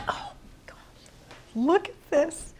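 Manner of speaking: breathless hushed whisper of wonder; awed whisper. Real, not acted. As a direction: whisper it in amazement. First look at finished bread.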